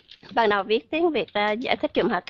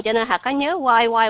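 ordinary talk

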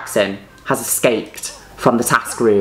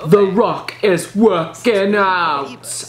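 much higher, very slow stretched announcer read; drawn-out announcement; 8 semitones higher, much slower than usual. Acted. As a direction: savour every word like a TV voice-over. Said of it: "I love saying that one".

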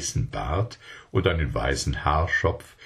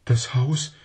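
put-on startled child; 7 semitones higher than usual. A narrator's reading. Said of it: "fragte Zweselchen erschrocken".

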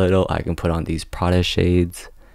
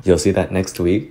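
usual speaking voice

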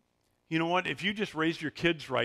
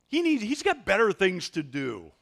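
dismissive; 5 semitones higher, 4 dB louder than usual. Retold or imitated. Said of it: "the disciples are like".

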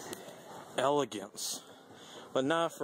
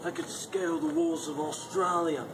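badly faked Aussie accent, slightly raised pitch; terrible Australian accent; 5 semitones higher than usual. Shopper joking about shoes that fit, terrible Australian accent. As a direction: put on a hammy, unconvincing Australian accent. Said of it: "that's a terrible accent".

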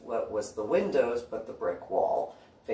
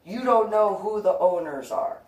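higher, louder heated retort; loud heated retort to intruder; 8 semitones higher, 4 dB louder than usual. Retold or imitated. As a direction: push back hard. Scolding the stranger.

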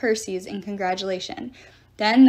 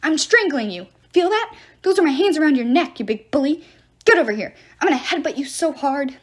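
raised, higher-pitched ranting; shrieked fury; 4 semitones higher, 4 dB louder than usual. A narrator's reading. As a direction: hurl threats like an enraged little official. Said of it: "Terminus shrieked".

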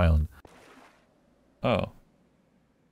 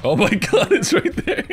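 laughing scared; 14 semitones higher, 5 dB louder than usual. Real, not acted. Laughing at monster.

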